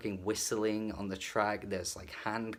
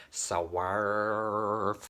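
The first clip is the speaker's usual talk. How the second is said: lightly mocking southern country twang; a hint of drawled southern singer; 3 dB louder than usual. Reviewer mocking the singer's southern twang, light imitation. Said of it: "the way he's like".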